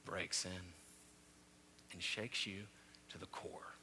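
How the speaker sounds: hushed whispered ending; preacher whispering the punchline. Real, not acted. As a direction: drop to a whisper.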